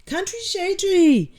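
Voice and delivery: high drawn-out name call; singsong greeting. Real, not acted. Calling out viewer's name in greeting.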